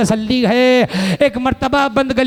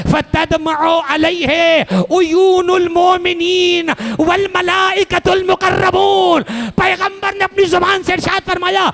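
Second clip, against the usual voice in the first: wailing sermon lament; 6 semitones higher than usual. Acted. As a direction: stretch each word out in mourning. Majlis lament at full force.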